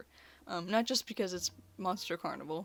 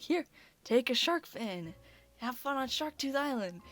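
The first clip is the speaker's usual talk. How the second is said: lightly voiced beach-shop goof; goofy vendor; 6 semitones higher, 5 dB louder than usual. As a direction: hand it over like a cheerful himbo. Voicing the goofy shark fin vendor.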